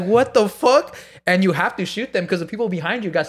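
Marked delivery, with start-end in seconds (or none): 0.0-1.0 high, loud incredulous question; shocked disbelief. Retold or imitated. Learning he must shoot other guests, shocked disbelief. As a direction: blurt it in reenacted horror.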